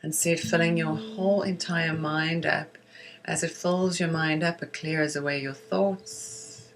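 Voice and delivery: measured meditation guiding with long pauses for breathing; guided meditation. Acted. Teacher guiding listeners through breath and silvery-light visualization, guided meditation voice. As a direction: lead listeners gently inward, leaving space after each phrase.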